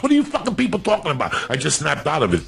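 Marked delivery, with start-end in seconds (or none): 0.0-1.3 high, fast outraged question; exasperated rant. Real, not acted. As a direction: fire it off, fed up. Rant peak.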